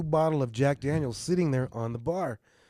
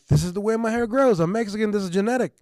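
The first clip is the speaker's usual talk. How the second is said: loud protest; 8 semitones higher, 6 dB louder, faster than usual. Retold or imitated. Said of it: "I'm like".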